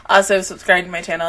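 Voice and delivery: normal talking voice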